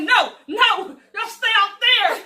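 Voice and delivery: high, loud, slow blocking the door; hammed-up keep out; mock shout. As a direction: hold them off, loud and high.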